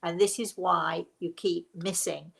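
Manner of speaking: ordinary talk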